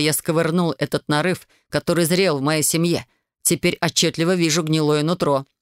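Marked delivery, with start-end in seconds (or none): none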